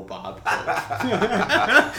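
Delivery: cracking up on the name; laughing. Real, not acted. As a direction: break into laughter mid-phrase. Jokes about his own nickname.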